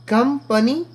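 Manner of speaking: कंपनी high, as "kum-pa-nee"; कंपनी in two beats, ending on a rise; pronunciation demo. Shown as a demonstration. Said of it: "इस तरह पढ़ें". (कंपनी का उच्चारण, ऊँचे सुर में)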